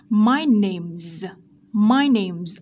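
"My name's" slow, ending on a buzzing z; careful teacher demo of "name's" with z; voiced Z ending. Shown as a demonstration. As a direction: model "name's" with the final s voiced as z. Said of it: "được đọc là Z".

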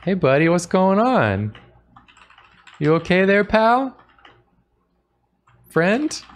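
chirpy peasant calling to a stranger; a hint of a cheery farm boy; friendly peasant. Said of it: "I'll say to the traveler".

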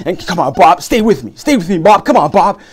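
loud, pleading cries to a collapsed man; urgent shout. Acted. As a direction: yell at him to stay alive. Urging a dying man on.

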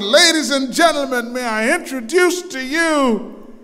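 booming high announcer call, building to the name. Retold or imitated. Preacher retelling an arena announcer, big booming call. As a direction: bellow it like an arena announcer introducing a star. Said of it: "the announcer said".

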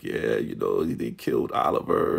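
low, subdued imitation of grief; upset. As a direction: drop the pitch way down, lightly acted dejection. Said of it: "screaming and so upset because".

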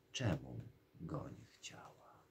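slow whisper "Czemum go nie chciała?", stressing "czemum"; whispered lament. Acted. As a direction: breathe the regret to herself in a whisper. Princess laments her refusal. (szeptem, powoli „Czemum go nie chciała?”)

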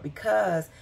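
ordinary talk

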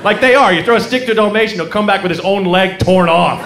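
normal talking voice

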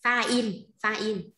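pha in said slowly twice, each syllable separate; slow model pronunciation. Shown as a demonstration. Teacher prompts a student with the word, slow and clear. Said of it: "khan, ờ".

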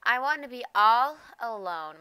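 usual speaking voice

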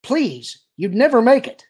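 urgent, high-pitched pleading; loud, anxious warning; sharp plea. A narrator's reading. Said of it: "Leia said sharply".

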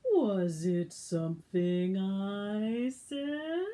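low slow sorry lion; sad slow lion. Acted. The lion wonders sadly why everyone ran.

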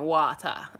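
"water" with an open Jamaican vowel; Jamaican accent. Shown as a demonstration.

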